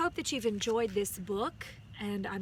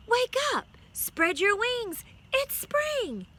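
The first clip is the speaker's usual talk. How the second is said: high, loud sing-song wake-up call; 12 semitones higher, 7 dB louder, slower than usual. A narrator's reading. The sun calling the sleeping ladybug awake, high and loud. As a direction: call brightly to rouse a sleeper.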